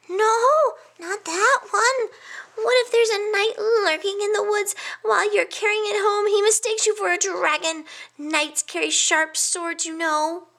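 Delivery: a nervous little hedgehog listing past disasters; fretful little hedgehog. A narrator's reading. Hedgehog friend warning the pig about his decisions, high fretful voice.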